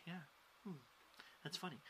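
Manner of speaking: very soft, muted acknowledgement with gaps; polite smiling non-reaction. Retold or imitated. Comic imitating people who just smile, quiet polite murmur. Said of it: "people who just smile at you like".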